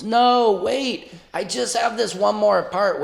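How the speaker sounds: high pleading voice; begging. Retold or imitated. Comedian begs the booing audience to wait, high and pleading. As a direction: beg the crowd to hold on. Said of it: "I'm begging the audience".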